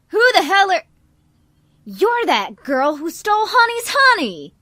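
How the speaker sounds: loud, high, furious accusation; angry outburst. Acted. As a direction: yell it at the thief who stole. Angry farmer Gordon confronts thief.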